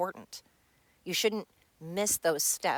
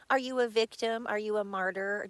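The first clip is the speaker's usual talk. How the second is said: mocking taunt; 4 semitones higher, 4 dB louder than usual. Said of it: "he'll even say really shitty things like".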